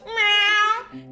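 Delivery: high loud imitated meow; cat meow. Imitating her cat at bedtime, high meow. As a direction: mimic the cat's demanding meow. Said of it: "he comes and he hops up on the bed and he goes".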